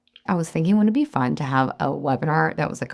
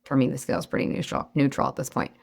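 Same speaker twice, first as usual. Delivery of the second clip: ordinary delivery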